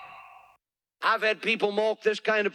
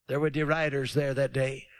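ordinary talk, nothing marked

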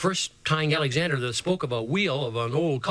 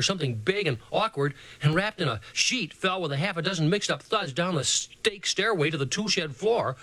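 usual speaking voice

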